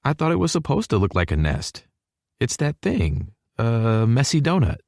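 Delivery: put-on high girl's character voice; girlish, put-out retort; defensive girl. A narrator's reading. Hallie's retort in dialogue.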